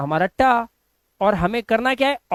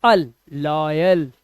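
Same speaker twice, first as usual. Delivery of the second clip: slow syllable demo; 5 semitones lower, much slower than usual. Shown as a demonstration. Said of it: "लेकिन लॉय के बाद अल भी है".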